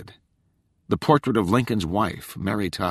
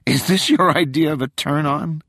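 incredulous; 9 semitones higher, 5 dB louder than usual. A narrator's reading. Rachel amused and incredulous.

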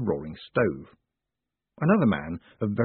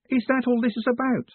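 much higher, delighted disbelief; mock surprise. A narrator's reading. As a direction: play it as pleasantly surprised. Moist beaming at the price.

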